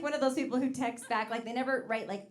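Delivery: usual speaking voice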